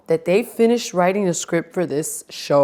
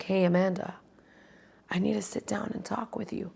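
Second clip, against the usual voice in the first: a soft, secret whisper asking to talk; whispered request; 13 dB quieter than usual. Retold or imitated. Recapping Summer House, Ben quietly asking Amanda to talk, whispered. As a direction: lean in and whisper it privately.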